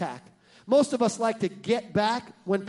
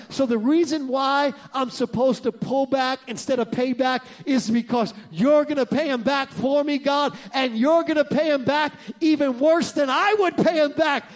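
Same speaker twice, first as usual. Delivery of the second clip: usual speaking voice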